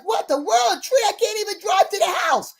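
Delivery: high-pitched, exasperated retold outburst; upset. Buyer finds a tree blocking the drive, acted-out upset. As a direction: act out indignant disbelief. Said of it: "They're upset".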